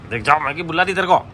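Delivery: ordinary talk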